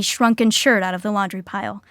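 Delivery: ordinary talk, nothing marked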